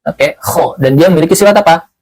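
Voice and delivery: usual speaking voice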